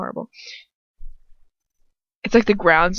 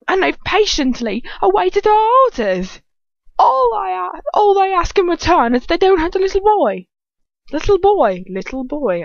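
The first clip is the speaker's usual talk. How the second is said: very high, loud, hammed-up villain voice; gleeful villain; 10 semitones higher, 5 dB louder than usual. A narrator's reading. Villain reporting the parents' terms, gleeful. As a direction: crow it in a piercing high voice.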